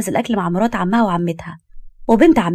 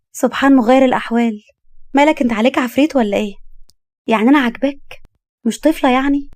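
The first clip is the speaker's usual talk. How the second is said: higher-pitched, playful questioning; lively, coquettish teasing; amused young wife, teasing; 4 semitones higher than usual. Acted. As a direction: fish for a compliment, lightly incredulous. Wife teases husband after laughing.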